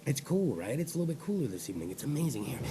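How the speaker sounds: low, hushed easy-listening voice; soft late-night murmur; talking soft. Pretend. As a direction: drop right down, very soft and low. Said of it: "I gotta talk soft".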